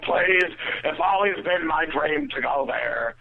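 high, slow, pleading caricature; annoying friend's voice. Retold or imitated. Friend begging to go to the bar, annoying put-on voice. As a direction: beg in a drawn-out mock-impaired voice. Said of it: "this girl talks like that".

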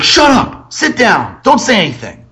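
high-pitched re-enacted outburst; exasperated scolding. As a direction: act out snapping at a kid, high. Retold outburst at misbehaving boy.

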